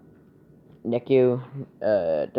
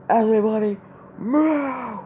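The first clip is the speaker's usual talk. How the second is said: imitated all-out yell; 12 semitones higher, 5 dB louder than usual. Boy hero clearing the way before his attack, imitated yell. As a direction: act out yelling as loud as you can. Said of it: "he yells as loud as he can".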